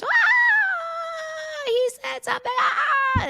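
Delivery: high-pitched mock crowd scream; imitating screaming crowd. Concert crowd reacting to the singer, imitated screaming. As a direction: screech like a crowd going wild. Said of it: "the whole place screams".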